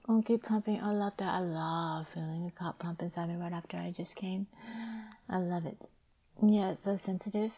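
normal talking voice

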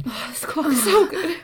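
ordinary delivery

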